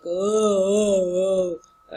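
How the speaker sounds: long, loud held "oh"; bass singing tone. Shown as a demonstration. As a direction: drone a sustained musical "oh". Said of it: "the deepest tone of voice and music".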